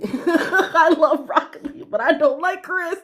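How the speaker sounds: high, laughter-filled delivery; laughing hard. Real, not acted. Viewer joking about broccoli and Chris, laughing hard. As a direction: talk through a real fit of giggles. Cracking up at her own joke.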